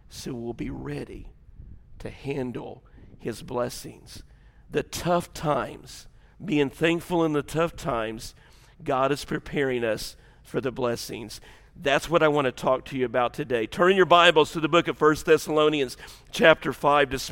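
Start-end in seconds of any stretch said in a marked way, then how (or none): 0.1-11.4 softer, slow sermon voice; soft, slow preaching. Acted. Preacher opening the sermon to the church, soft and slow. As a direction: lean in gently on the tough times.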